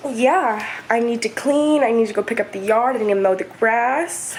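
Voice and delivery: usual speaking voice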